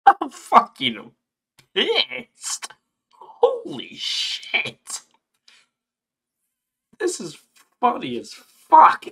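high, squeaky laugh-through-rage; exasperated laughing. Real, not acted. Gamer laughing at their own fury with the horse, exasperated laughing. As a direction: crack up at how mad you are.

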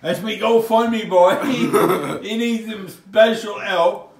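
hammed-up begging. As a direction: overplay a desperate fundraiser pitch.

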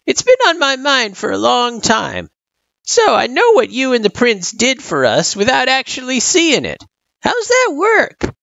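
put-on high-pitched inquiring old-timer; old turtle, curious. A narrator's reading. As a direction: ask as a puzzled old turtle.

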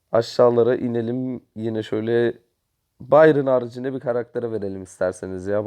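ordinary delivery

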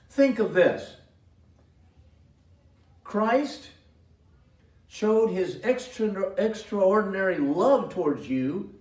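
loud, rising sermon voice; preaching. Acted. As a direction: preach it out with fire. Preaching at full force.

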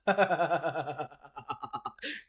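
long, loud stuttering cackle; unhinged machine-gun laughter; hysterical laugh. Real, not acted. Player cracking under fear, manic cackle. Hysterics while playing horror game.

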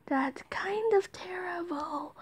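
breathy whispered aside; whispering. Real, not acted. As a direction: slowly whisper the verdict. Aside after frantic shouting.

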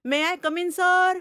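yelled, high, deliberately harsh request; harsh loud voice. Acted. Mussolini yells his entry at the teacher, harsh and high. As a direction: blast it to mock the rule. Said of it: "मुसोलिनीचा कर्कश आवाज".

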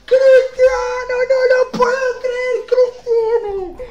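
high, drawn-out celebratory yell; real excited shouting. Real, not acted. Streamer celebrates pulling Cristiano from a pack, ecstatic shouting. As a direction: scream it out in amazement, stretching the name.

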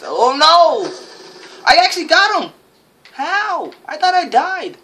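high, loud exclamations; excited outburst. Real, not acted. Gamer reacting to a shock kill, excited outburst. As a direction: cry out in loud disbelief.

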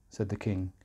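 ordinary delivery, nothing marked

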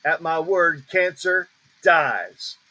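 long, separated "dies" at the end; pause, then stretch out "dies" firmly; stress on DIES. Shown as a demonstration. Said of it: "You hear the emphasis?".

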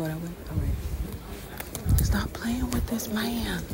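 hushed whisper so nobody hears. Real, not acted. Fan at the museum keeping it down for the camera, whispering. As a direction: breathe it out under the crowd noise. Quiet in crowded museum gallery.

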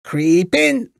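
high witch's command. A narrator's reading. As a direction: coax and order in a high witch voice. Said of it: "said the witch".

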